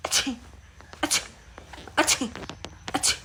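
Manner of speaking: a run of small sneezes; sneezing. Acted. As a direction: sneeze it out, like a fresh cold. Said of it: "लगता है मुझे सर्दी हो गई". (ठंड लगने पर छोटी छोटी छींकें)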